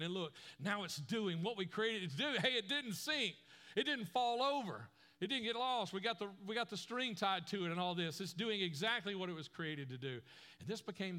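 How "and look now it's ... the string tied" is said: higher, louder excited cheer; thrilled boy celebrating with dad; excited kid. Retold or imitated. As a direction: cheer that the boat floats.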